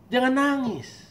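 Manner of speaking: high, gentle reassurance; God consoling a weeping believer; comforting. Acted. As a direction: soothe someone in tears. God comforting the tested servant.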